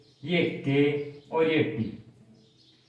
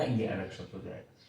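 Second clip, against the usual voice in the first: ये अलग शब्द हो जाएगा repeated much softer and lower; quiet echo; 9 semitones lower, 11 dB quieter than usual. Shown as a demonstration. Teacher repeating his warning to students, softly and lower. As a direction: drop to a soft low repeat. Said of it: "अगर इसको ऐसे बना दिया".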